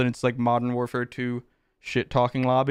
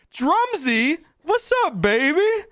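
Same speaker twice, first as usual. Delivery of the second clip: much higher, louder hype shout-out; excited greeting; 14 semitones higher, 4 dB louder, slower than usual. Real, not acted. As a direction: hype up the donor by name. Greeting a donor.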